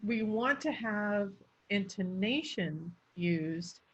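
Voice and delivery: slow, with "intonation" set off by a pause; deliberate emphasis on "intonation", elongated. Shown as a demonstration. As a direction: stretch "intonation" after a short pause. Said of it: "I'd pause right before that".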